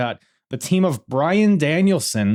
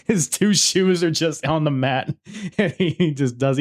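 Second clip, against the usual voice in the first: higher, breaking into laughs between phrases; laughing while talking; 5 semitones higher, slower than usual. Real, not acted. Host recounting a wrestler losing his shoes, laughing. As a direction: laugh through the story.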